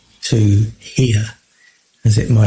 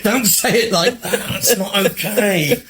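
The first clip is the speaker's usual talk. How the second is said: higher, laughing mock telling-off; laughing scold; 8 semitones higher than usual. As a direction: giggle through a playful 'don't say it like that'. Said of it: "their friends go".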